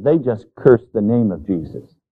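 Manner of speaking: normal talking voice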